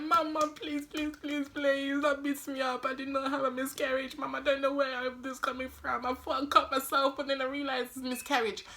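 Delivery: high, frantic hammed-up begging; fake dramatic pleading. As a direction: overplay the innocent child begging not to be beaten. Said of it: "all this drama faking".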